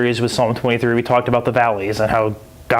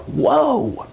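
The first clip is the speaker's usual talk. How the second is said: amazed whoa; 11 semitones higher than usual. Retold or imitated. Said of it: "The disciples are like".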